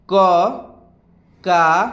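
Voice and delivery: syllables one by one with long gaps; slow recitation. Shown as a demonstration. Teacher leading students to repeat along, slow recitation. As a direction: recite each sound separately, unhurried. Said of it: "धीरे धीरे उच्चारण करते चलिएगा".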